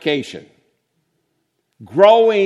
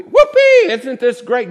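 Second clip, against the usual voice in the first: shrieked mock cheer, much higher and louder; sarcastic whoopee; 9 semitones higher, 9 dB louder than usual. Preacher mocks cheering at a family death, shrieked sarcasm. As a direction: ironic delight, overdone on purpose.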